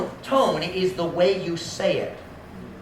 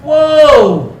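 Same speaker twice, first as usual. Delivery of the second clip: loud, drawn-out alarmed whoa; loud falling whoa; big whoa; 6 semitones higher, 11 dB louder than usual. Acted. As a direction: react to a bomb going off. Mock explosion reaction.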